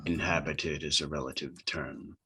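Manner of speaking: low, softly intoned entity voice; attempted booming voice. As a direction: answer low and quiet as the mysterious transmission. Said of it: "you hear in a booming voice".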